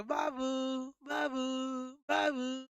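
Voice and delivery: Babu repeated like a fading echo. Shown as a demonstration. Teacher imitating an echo for the class. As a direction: let Babu bounce back as an echo. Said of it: "ये इको है".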